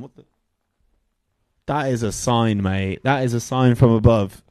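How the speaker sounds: ordinary delivery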